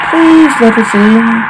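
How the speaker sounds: put-on high, slow, eerie child's plea; a ghostly child begging to come in. Ghost child at the door begging the family, eerie and slow. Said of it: "Children's voice".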